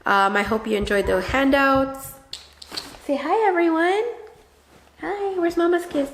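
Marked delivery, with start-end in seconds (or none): 3.0-6.1 high sing-song mom voice. Real, not acted. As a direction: coo at the little one. Talking to her child.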